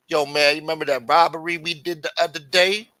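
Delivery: high, loud oblivious question; indiscreet caller. Retold or imitated. Lameek trying to talk about the robbery, loud high blurting. Lameek talks too openly on phone.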